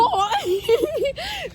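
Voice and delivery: sudden high-pitched laugh; high squealing laugh. Real, not acted. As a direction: burst into a long, high giggle. Laughing at someone in the store.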